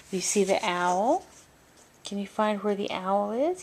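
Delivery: ordinary delivery, nothing marked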